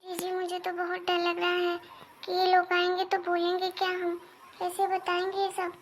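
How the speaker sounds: very soft, scared murmuring; two sisters-in-law whispering nervously to each other, very soft; hushed fearful talk. Acted. Scared sisters-in-law hush together.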